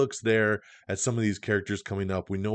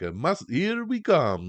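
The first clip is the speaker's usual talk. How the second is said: put-on slight Italian accent; 6 semitones higher than usual. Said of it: "slight Italian accent".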